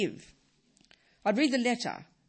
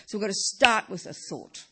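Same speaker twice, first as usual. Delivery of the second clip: ordinary talk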